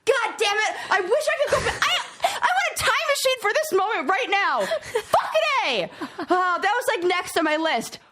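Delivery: high, laughing self-scolding; laughing excitedly. Real, not acted. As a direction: laugh at your own blunder, worked up. Still worked up after blurting.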